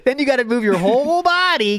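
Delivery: a light mock of Phil; imitating Phil. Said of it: "in a similar manner to Phil".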